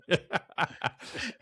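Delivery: high, real laugh. Real, not acted. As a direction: crack up at the joke. Host laughs at the joke.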